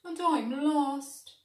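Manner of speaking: a small dog admitting he's lost; little dog voice. A narrator's reading. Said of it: "said the little dog".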